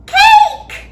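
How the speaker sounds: put-on very high, loud baby shriek. A narrator's reading. Sunny demanding cake, a very high loud shriek. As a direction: shriek it with glee. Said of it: "Sunny shrieked".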